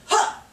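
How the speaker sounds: sharp grunt on a punch; effort grunt. Real, not acted. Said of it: "I use all the noises".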